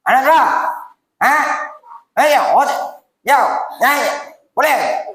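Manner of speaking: imitating a gruff uncle with a sharp stern voice; half-hearted scolding of a child; mock stern glare. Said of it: "sambil jegik". (meniru pakcik garang menjegil kanak-kanak)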